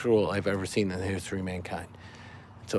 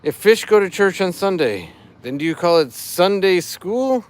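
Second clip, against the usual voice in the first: higher, louder corny fish puns; dad joke voice; 6 semitones higher, 4 dB louder than usual. Acted. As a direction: ham up the pun like a dad. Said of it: "I'm doing it in dad joke voice".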